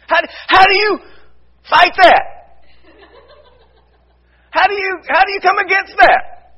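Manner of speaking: high and loud, pleading rhetorical questions; full-force preacher's appeal; impassioned preaching. Real, not acted. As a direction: press the congregation hard. Rising preaching climax.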